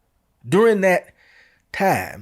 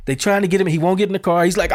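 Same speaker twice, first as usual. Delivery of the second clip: usual speaking voice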